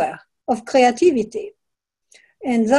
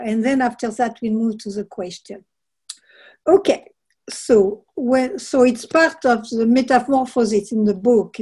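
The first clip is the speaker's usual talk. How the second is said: usual speaking voice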